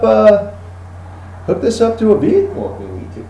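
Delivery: high, soft, hyped product pitch; hushed gun dealer. Acted. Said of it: "he's like".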